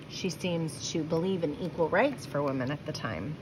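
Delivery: ordinary delivery, nothing marked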